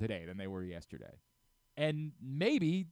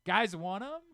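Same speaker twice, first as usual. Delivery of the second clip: mock generous giveaway; 9 semitones higher, 4 dB louder than usual. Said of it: "they're just like".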